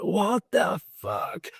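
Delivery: incredulous. Retold or imitated. Patient reacting to an absurd question from his therapist, incredulous. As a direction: stretch it out in bewildered outrage.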